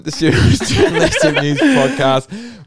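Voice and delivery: amused intro breaking into giggles; laughing. Real, not acted. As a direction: laugh while announcing the show. Laughing through show intro.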